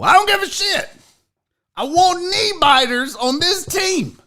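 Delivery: hammed-up fanatical coach; Dan Campbell. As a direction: whip the team into a frenzy. Acted Dan Campbell rallies his team.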